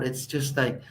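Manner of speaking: usual speaking voice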